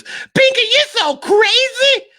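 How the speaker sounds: very high, slow squealing voice; Miss Fatima. Retold or imitated. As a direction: squeal it like a giddy cartoon girl. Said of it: "all she ever says is".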